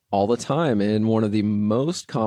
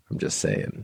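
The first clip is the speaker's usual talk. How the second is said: whispered aside; 6 dB quieter than usual. Real, not acted. Muttered aside.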